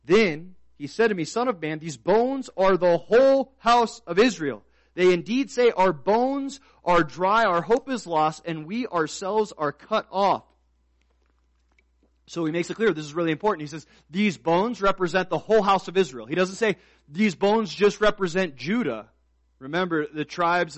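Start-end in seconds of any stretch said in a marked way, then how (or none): 0.1-10.5 loud, raised pulpit reading; forceful scripture reading. Real, not acted. Preacher reading Ezekiel to the church, forceful scripture reading. 14.1-19.1 loud, raised pulpit reading; forceful scripture reading. Real, not acted. Preacher reading Ezekiel to the church, forceful scripture reading.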